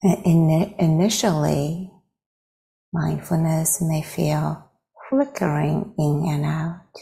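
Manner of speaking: unhurried phrases with long silences between; guided meditation. Real, not acted. Teacher leading meditators into arriving with the breath, guided meditation. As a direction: guide gently, leaving space after each phrase.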